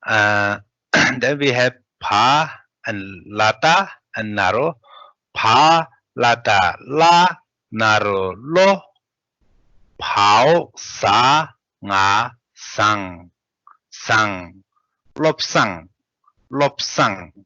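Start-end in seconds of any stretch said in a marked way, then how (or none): 5.3-17.3 teacher spelling the word aloud; spelling drill. Shown as a demonstration. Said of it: "let's read spell out".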